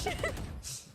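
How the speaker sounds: high breathy evil cackle; villain laugh. Real, not acted. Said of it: "You don't like my villain laugh?".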